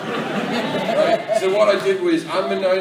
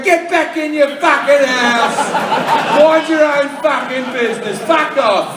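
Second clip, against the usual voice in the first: high, loud bellow at nosy neighbours; acted raging outburst, slow and loud; furious shout; 8 semitones higher, 9 dB louder than usual. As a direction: yell them off at full force. Yelling at neighbours in acted quarrel.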